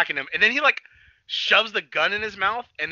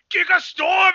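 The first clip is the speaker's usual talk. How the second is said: shouted attack name; 13 semitones higher, 7 dB louder, slower than usual. Real, not acted. Recapping the episode to his friend, shouting the attack name. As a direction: yell the move's name way up high.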